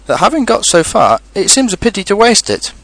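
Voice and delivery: high, lightly voiced fussy rabbit; reasoning rabbit. Rabbit arguing against pushing Pooh back, reasoning. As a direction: make the case as a practical rabbit.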